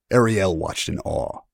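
ordinary delivery, nothing marked

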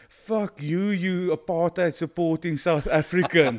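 retold angry yell with a laugh breaking in; mock furious insult. Drunk patron swears at the bar manager on his way out, mock furious yell.

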